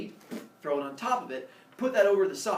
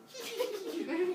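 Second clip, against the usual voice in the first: high, softer mischievous giggle; giggling; 5 dB quieter than usual. Acted. Humans running off with their pile, giggling. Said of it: "take off running, giggling".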